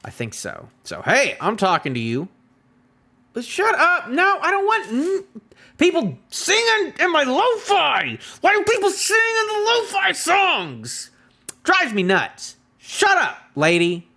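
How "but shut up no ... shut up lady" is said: very high-pitched exasperated whine; squeaky, worked-up grumbling; exasperated outburst. Real, not acted. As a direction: complain at the top of your range, drawing words out. Complaining at singing in lo-fi music.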